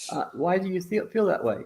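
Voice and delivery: usual speaking voice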